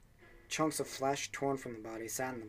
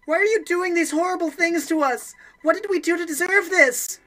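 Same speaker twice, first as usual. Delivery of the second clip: much higher, much louder, pleading shriek; desperate scream; 15 dB louder than usual. Acted. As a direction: scream to be let out. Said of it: "She screamed it again, a desperate, mindless cry".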